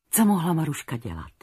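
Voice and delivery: ordinary delivery, nothing marked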